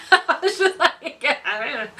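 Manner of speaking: words breaking up into real laughter; laughing. Real, not acted. Laughing at own accidental cleverness.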